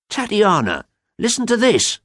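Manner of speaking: high, loud call for attention; excited cry. A narrator's reading. Bainbridge calls his sister to hear the news, excited cry. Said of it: "he cried".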